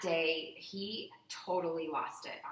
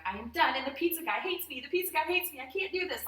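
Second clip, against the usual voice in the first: high, loud, climbing wail; mock sobbing meltdown; 11 semitones higher, 8 dB louder than usual. Mother reenacting her breakdown over the pizza guy, mock sobbing. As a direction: wail it higher and higher in hammed-up despair. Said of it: "I'm sobbing".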